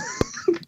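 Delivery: squealing burst of laughter; high laugh. Real, not acted.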